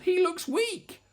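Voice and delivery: high, drawn-out complaint; grumbling. A narrator's reading. Mother T disappointed in her baby, high grumbling. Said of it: "and she grumbled".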